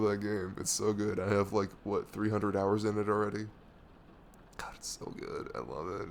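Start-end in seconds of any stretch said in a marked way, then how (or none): 4.6-5.5 breathless whisper of adoration. Real, not acted. Gamer whispering to viewers about the game she loves. 5.5-6.1 low and soft. Real, not acted. Gamer murmuring low to viewers about a game.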